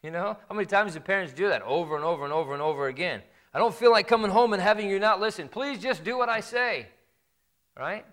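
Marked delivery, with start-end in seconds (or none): 3.5-6.9 higher, louder pleading whine of a worn-out parent; whining parent. Retold or imitated. Preacher mimics a parent whining at a child who won't listen. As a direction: beg like a tired parent, slowing on the last plea. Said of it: "don't whine for it".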